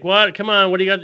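imitating a wrestler taunting the crowd; mock taunting wrestler. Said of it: "the whole putting her hand up to her ear".

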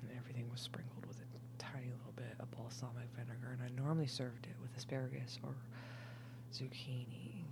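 hushed, breathy whisper. Real, not acted. Tipsy woman whispering about her salmon recipe, hushed. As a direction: whisper it like a delicious secret.

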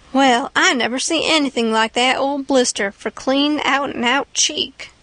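ordinary delivery, nothing marked

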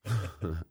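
soft brief chuckle; short laugh. Real, not acted. Recalling the dog biscuit, soft short laugh. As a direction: chuckle quietly at an absurd memory.

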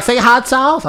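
high, loud mimicked request; imitated excited woman. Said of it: "She was like".

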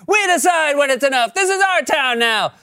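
high-pitched defiant shout; kids yelling back. Acted. Kids taking over from the neighbor, high mock yell. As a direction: shout it like a bratty kid gang. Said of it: "the kids yell back".

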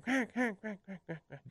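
spoken quacks starting high, stepping down and softening; descending quack cadence. Shown as a demonstration. Duck caller showing the host a cadence, quacks falling in pitch. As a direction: imitate a duck cadence down the scale. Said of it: "start at a high pitch and you come down the scale to a low pitch".

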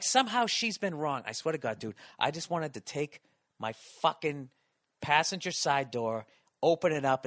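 ordinary talk, nothing marked

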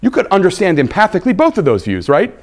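ordinary delivery

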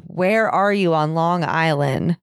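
normal talking voice

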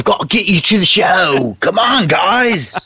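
high-pitched bad British rock-singer accent. Podcaster botching a rock star's show call, mock British accent. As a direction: call it out in a hammy British accent, drawing out the end. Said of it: "[name] impression doesn't make any sense".

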